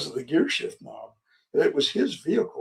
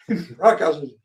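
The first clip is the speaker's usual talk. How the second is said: normal talking voice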